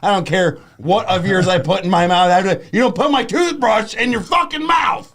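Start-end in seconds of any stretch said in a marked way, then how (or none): 2.3-5.1 angry rant. Real, not acted. Angry rant about toothbrush.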